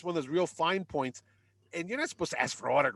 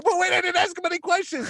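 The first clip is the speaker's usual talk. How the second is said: high-pitched flustered outburst; retold panicky squeal of objection; panicked protest; 13 semitones higher, 4 dB louder than usual. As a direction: blurt it out in alarm, much higher. Time called, he protests.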